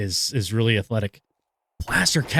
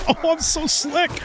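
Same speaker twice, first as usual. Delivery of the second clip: smug gloat; slower than usual. Acted. Gloating after a sneaky kill.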